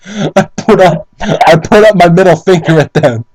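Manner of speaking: normal talking voice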